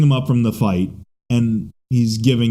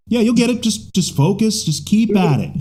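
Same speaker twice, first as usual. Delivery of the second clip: mock encouraging; 10 semitones higher than usual. Acted encouragement to Batgirl.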